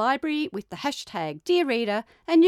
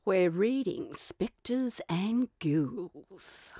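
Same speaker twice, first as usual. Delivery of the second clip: spooky voice; 2 semitones lower, 4 dB quieter, much slower than usual. Pretend. Announcing spooky theme.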